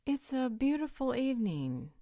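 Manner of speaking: loud, high-pitched change of subject; breezy deflection. Acted. Hostess steers everyone to the veranda, breezy and loud. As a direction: sing out a pleasantry to move things along.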